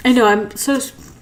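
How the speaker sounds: ordinary delivery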